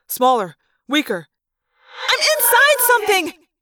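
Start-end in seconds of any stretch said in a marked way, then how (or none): 2.1-3.5 panicked. A narrator's reading. Rachel trapped inside the creature, panicked high cry. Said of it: "Rachel said".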